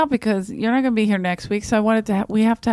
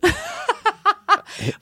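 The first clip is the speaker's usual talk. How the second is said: high burst of laughter; cracking up; 13 semitones higher than usual. Real, not acted. Big laugh at story.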